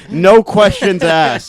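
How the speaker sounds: normal talking voice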